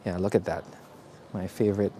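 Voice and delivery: ordinary talk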